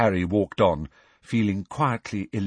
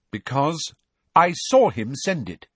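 louder, raised voice; angry; 6 semitones higher, 5 dB louder than usual. Acted. Cho defending Harry to Filch, angry. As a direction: stand up for him sharply. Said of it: "said Cho angrily".

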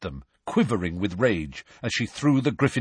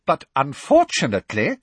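higher, stiffly polite; forced smile; 6 semitones higher, 4 dB louder than usual. A narrator's reading. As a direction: force courtesy through a fixed smile. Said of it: "attempt at a reciprocal smile".

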